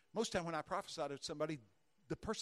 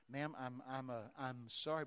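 apologetic; 3 semitones lower, 4 dB quieter, slower than usual. Retold or imitated. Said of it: "now I'm apologetic".

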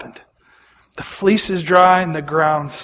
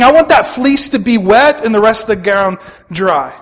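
high, loud, insistent plea to God; bargaining Gideon; 6 semitones higher, 6 dB louder than usual. Acted. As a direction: bargain with God, voice pitched up and pushing.

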